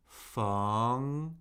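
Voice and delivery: "feng" rising steadily; second tone. Shown as a demonstration. Teacher cycling four tones on a syllable, "feng" rising. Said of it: "Let's do the four tones".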